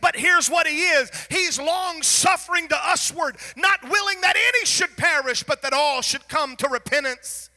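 loud, rising, fervent preacher cadence; full-force preaching. Acted. Preacher to his church on God's mercy, in a full-force preaching voice.

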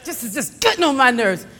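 strained mock exasperation; aggravated complaint. Preacher retelling his drive in traffic to the Lord, aggravated. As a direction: imitate grumbling through clenched teeth. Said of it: "I'd be so aggravated driving to work".

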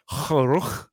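"kharukh" drawn out with a hard throaty end; guttural Arabic sound. Shown as a demonstration. Demonstrating the hardest sound in Arabic, guttural "kharukh". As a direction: rasp "kharukh" from the back of the throat. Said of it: "like the hardest sound at the end".

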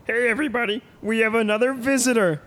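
put-on high, bright childlike man-child voice; squeaky excited host greeting; Pee-wee Herman voice. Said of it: "Pee-wee goes".

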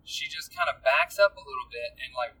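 ordinary delivery

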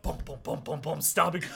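ordinary talk

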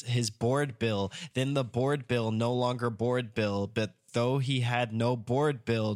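tongue twister read slowly; slow, careful. Shown as a demonstration. Host carefully reading a tough tongue twister. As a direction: take each word slowly. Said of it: "This is very tough".

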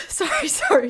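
high, loud flustered sorry; flustered apology. Real, not acted. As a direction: blurt the apology right after sneezing. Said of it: "Oh, I'm sorry".

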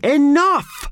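high, loud angry shout. A narrator's reading. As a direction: bark it to stop the noise. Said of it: "shouted Howard".

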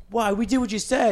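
loud, high, indignant outburst; outraged son. Acted. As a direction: blurt it like a spoiled son caught out. Son protests to father, quote.

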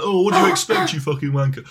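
a rude waiter shouting at a customer. Pretend. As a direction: bark it at the diner. Imagined rude waiter line.

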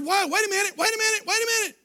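high, loud mock protest; classmates protesting. Classmates protesting his skipped words.

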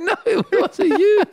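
high, loud panicked pleading; panicked denial. Pretend. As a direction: beg in a high frightened voice. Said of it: "You'd be like".

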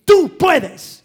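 loud, high cry of Tú puedes; shouting encouragement. Retold or imitated. Father shouting courage at his discouraged son. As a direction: shout the belief into him. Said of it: "Y yo comencé a gritarle".

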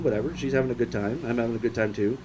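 low, soft, letting it go; quiet resigned shrug. Retold or imitated. Resigned inner thought.

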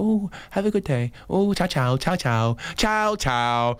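high, soft sing-song ciaos; cheery passing farewell. Retold or imitated. Cyclist bidding walkers goodbye, soft sing-song. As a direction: wave goodbye sweetly as you ride. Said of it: "then you go".

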